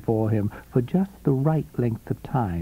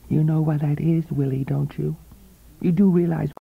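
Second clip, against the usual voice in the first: higher, knowing, silvery woman's voice; 7 semitones higher than usual. A narrator's reading. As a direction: coax him like a confidante. Coral's voice in the story.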